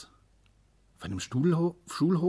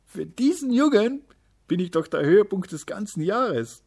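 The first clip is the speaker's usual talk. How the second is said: high, loud happy voice; happy thought; 11 semitones higher, 9 dB louder than usual. A narrator's reading. Little day happily thinking about the boy. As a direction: glow with joy. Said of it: "dachte der kleine Tag glücklich".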